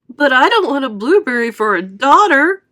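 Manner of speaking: high, loud indignant yell. A narrator's reading. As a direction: protest hotly. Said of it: "yelled Missus Beauregard".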